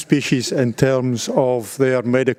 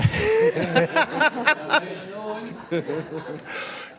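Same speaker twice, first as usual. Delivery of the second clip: a long, high burst of real laughter; hearty laugh; 11 semitones higher, 5 dB quieter than usual. Real, not acted. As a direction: crack up at your own joke. Laughs at own farm joke.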